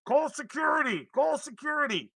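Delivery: high, loud cry from inside; panicked shout for security. Acted. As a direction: yell the alarm at a high pitch. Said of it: "you hear from inside".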